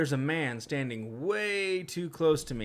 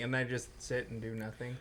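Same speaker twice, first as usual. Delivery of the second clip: usual speaking voice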